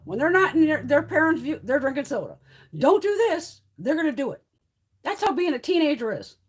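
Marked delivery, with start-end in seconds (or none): 2.8-3.6 high-pitched mock parental order; forbidding parent. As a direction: lightly mimic a scolding parent. Voicing a parent forbidding things.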